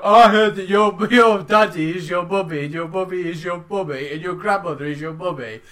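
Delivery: mocking playground bully voice; sing-song taunt at the kid; schoolyard taunt. School bully taunting the kid about his family, mocking. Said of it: "somebody go".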